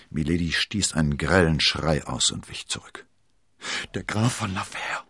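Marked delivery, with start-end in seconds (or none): 3.9-5.0 a gasped, strained name. A narrator's reading. Milady gasping as she recognises her husband. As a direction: choke out the name in shock. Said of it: "ächzte sie".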